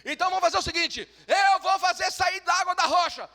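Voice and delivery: high, loud furious shout; losing control. Acted. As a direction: blow up at the crowd. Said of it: "Ele ficou bravo e perdeu o controle".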